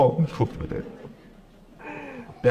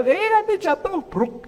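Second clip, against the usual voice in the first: high, alarmed warning; anxious mother warning; 12 semitones higher, slower than usual. Retold or imitated. Mother to child climbing high, high alarmed warning.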